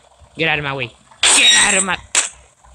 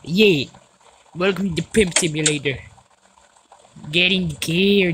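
ordinary talk, nothing marked

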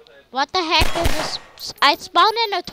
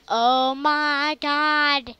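usual speaking voice

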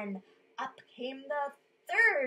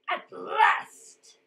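loud, high troll outburst; gloating troll; 10 semitones higher, 12 dB louder than usual. A narrator's reading. Troll greeting his long-awaited meal, loud gloating. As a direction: burst out with hungry triumph.

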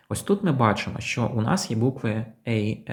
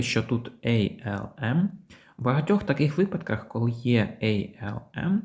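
ordinary talk

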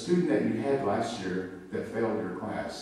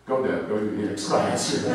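louder hurried urging to yourself; flustered self-talk; 7 dB louder than usual. Retold or imitated. Teacher urging herself on after emotional moment, flustered. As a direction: pull yourself together and move on.

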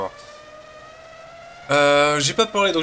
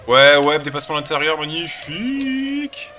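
loud, then much higher on a slow drawn-out magnifique; excited cheering; 5 semitones higher, 8 dB louder, slower than usual. Real, not acted. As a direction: cheer the overtake, stretching the last word. Celebrating a pass while racing. (joueur qui fête son dépassement, crie de joie)

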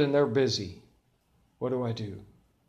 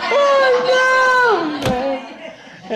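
loud, much higher cry of horror; a big comic wail of dread; mock dismayed shout; 10 dB louder than usual. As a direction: yelp it like you've just been caught. Dismay as church lady approaches.

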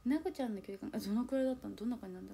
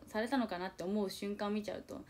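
ordinary talk

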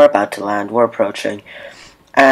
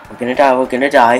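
louder, fast imitated panic; mock frantic panic; 8 dB louder, faster than usual. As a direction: rattle it off like a panicking flyer. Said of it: "She gets so frantic".

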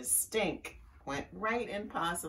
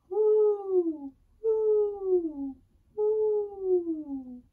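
high, falling "woo" hoots; owl hooting; 13 semitones higher, 7 dB louder, much slower than usual. A narrator's reading. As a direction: hoot like an owl up in a tree.

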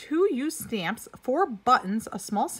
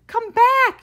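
high, loud call after a runaway goat; a child's yell to a fleeing goat; hollered; 14 semitones higher, 12 dB louder than usual. A narrator's reading. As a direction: shout it down the street, much higher and louder. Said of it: "called Farmer Greenfield".

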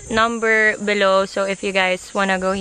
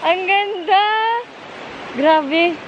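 excited; 10 semitones higher, slower than usual. Real, not acted. Amazed at the view.

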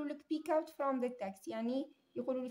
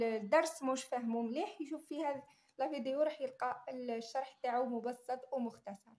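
ordinary delivery

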